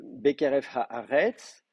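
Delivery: slow, deliberate reading of "Bekeref Haaretz"; slow careful reading. Shown as a demonstration. Teacher reads the verse slowly for students. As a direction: spell the phrase out slowly for the class. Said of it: "donc l'accent il tombe, euh, ici".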